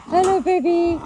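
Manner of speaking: high, loud hello to an animal. Real, not acted. Greeting a piglet.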